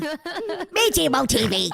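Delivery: high, drawn-out full-name scolding; scandalised scolding. Real, not acted. Streamer scolding Michi by full name, scandalised. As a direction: stretch the name out in high-pitched outrage.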